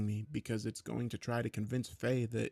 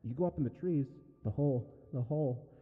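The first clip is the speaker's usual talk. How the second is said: rambling creepy mimic voice; eerie yammering mimic; 5 semitones higher than usual. A narrator's reading. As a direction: babble it out like a creature. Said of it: "kept yammering".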